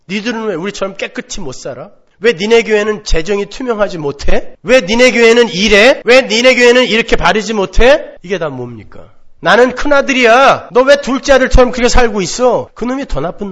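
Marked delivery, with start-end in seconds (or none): 0.1-8.2 building to loud accusing questions, each 왜 rising; mounting rebuke. Retold or imitated. Self-righteous believers attacking other churches, building loud rebuke. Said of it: "마구 질타를 할 수 있는". 9.4-12.7 loud, higher, looking down on him; arrogant scorn. Retold or imitated. Elder son sneering at his younger brother, loud arrogant scorn.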